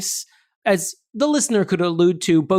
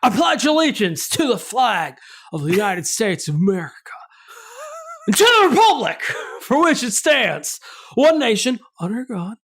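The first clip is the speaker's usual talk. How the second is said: Nicolas Cage pledge; 4 semitones higher than usual. Pretend. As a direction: bellow the pledge like an unhinged movie hero. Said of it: "recite the Pledge of Allegiance as Nicolas Cage".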